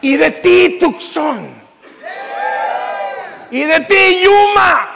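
loud, soaring pulpit preaching, words stretched; preaching at full force. Acted. Pastor preaching to the congregation, at full force. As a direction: preach it out to the whole church. Calling out to cities.

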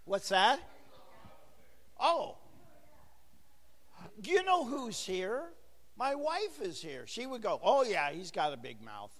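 ordinary talk

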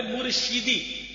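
"مرشدی" slowly as murshidi, short i after sh; careful, stretched "مرشدی" with shi; correct pronunciation. Shown as a demonstration. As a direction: say "مرشدی" with zer under the shin, murshidi. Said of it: "شین کے نیچے زیر ہے".

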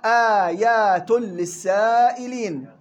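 slow Quranic chant; slow Quran recitation. Shown as a demonstration. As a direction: recite آيات للسائلين slowly and measured. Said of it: "في قوله تعالى".

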